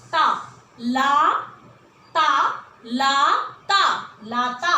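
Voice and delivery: slow, stretched ता and ला apart; slow syllable drill. Shown as a demonstration. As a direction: spell out ता ... ला syllable by syllable. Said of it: "ताला". (धीरे धीरे अक्षर अलग अलग)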